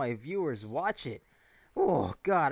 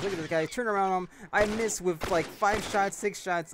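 excited; 6 semitones higher, 4 dB louder than usual. Real, not acted. Frustrated reaction during firefight.

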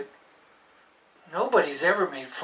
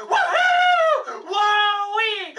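joyful whoop; much slower than usual. Acted. Celebrating learning a word.

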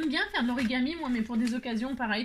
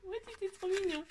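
gushing squeal; 10 semitones higher, 5 dB quieter than usual. Real, not acted. Gushing over cute gift.

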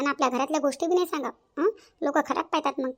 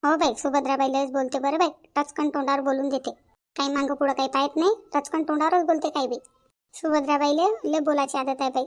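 ordinary delivery

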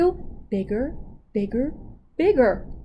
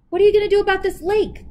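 higher, louder and fast; demanding; 10 semitones higher, 6 dB louder, much faster than usual. Acted. Animals confront Hyena about the lake, demanding. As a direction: fire the question at him.

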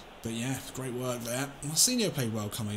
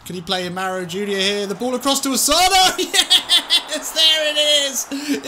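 much higher, louder ecstatic shouting; goal celebration; 8 semitones higher, 8 dB louder than usual. Real, not acted. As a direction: scream the late winner with joy. Late winning goal.

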